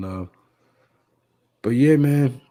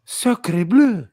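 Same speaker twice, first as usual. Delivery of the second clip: put-on French accent; 8 semitones higher, 6 dB louder than usual. Mock French after Levance's name.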